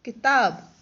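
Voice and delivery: "किताब" said loudly and clearly; teacher revealing the finished word. Shown as a demonstration. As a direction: announce "किताब" with a loud finish.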